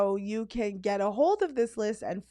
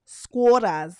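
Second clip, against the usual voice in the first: Squatters in a louder, hammed-up Australian accent; mock Aussie accent; 9 dB louder than usual. Mocking the Australian pronunciation, hammy Aussie accent. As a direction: play the Australian, Squatters drawn out Aussie-style. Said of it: "get our Aussie accents together".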